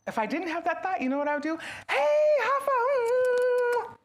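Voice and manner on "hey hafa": high, loud, slow stretched hello; delighted greeting. Pretend. Greeting Hafa without the grumpy thought, delighted. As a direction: sing out his name with joy.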